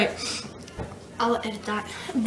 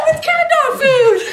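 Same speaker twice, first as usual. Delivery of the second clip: excited shout; 10 semitones higher, 4 dB louder than usual. Real, not acted. Excited win after lucky pick.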